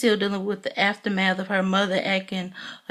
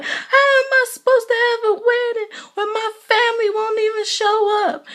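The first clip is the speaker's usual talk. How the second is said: much higher, louder, tearful plea; mock crying; 14 semitones higher, 4 dB louder than usual. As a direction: imitate a weepy bride's lament. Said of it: "she just started breaking down, crying".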